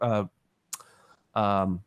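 usual speaking voice

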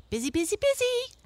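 high, fast, chirpy chipmunk voice; busy chipmunk. A narrator's reading. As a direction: rattle it off like a hurried little chipmunk. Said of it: "said Striped Chipmunk".

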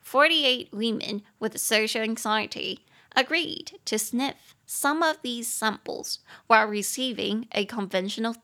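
放慢语速朗读整句; 清楚地逐词慢读; slow model reading. Shown as a demonstration. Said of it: "teacher reads sentence slowly". (老师慢速示范朗读)

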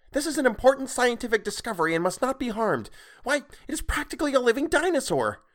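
put-on very high, clear, insistent child voice; high-pitched indignant lecture; shrill girl. A narrator's reading. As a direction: protest shrilly as a young girl. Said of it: "voice clear and shrill and loud".

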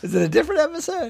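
high, fast confused question; surprised. Real, not acted. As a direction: squeak it out, baffled.

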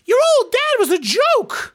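very high, slow hype man voice. Retold or imitated. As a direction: yell it like a squeaky hype man. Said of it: "doing the hype voice".

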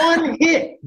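"one hit" pitched far up, each word weighed; emphatic one hit. Real, not acted. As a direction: lift "one" high to stress how small the ask is. Stressing one big hit.

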